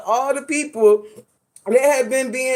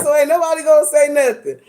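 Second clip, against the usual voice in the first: indignant; 6 semitones higher, 4 dB louder than usual. Retold or imitated. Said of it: "I said".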